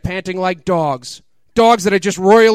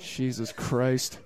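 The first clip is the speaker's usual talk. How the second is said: exasperated mutter; 6 semitones lower, 14 dB quieter, much slower than usual. Real, not acted. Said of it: "author's exasperated aside".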